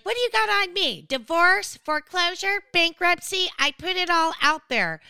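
loud, high imitation of a defiant reality star; mock defiant rant. Mocking a cast member's defiant list, loud and high. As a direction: belt it out mocking her brag. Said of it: "she's like".

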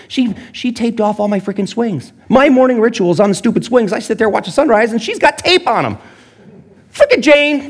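hammed-up outrage over the swings; mock indignant rant. Pastor ranting about taped-off swings, mock indignant. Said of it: "I'm so pissed".

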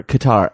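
"Qatar" as kuh-TAR; "Qatar" rhyming with guitar; alternative pronunciation. Shown as a demonstration. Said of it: "How do you guys pronounce it?".

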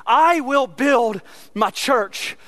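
I will build my church, each word slow and weighted; slow emphatic. Acted. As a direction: savour every word of it. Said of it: "I absolutely love that verse".

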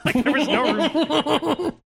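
slow, high, laughing through words. Real, not acted. Laughing hard.

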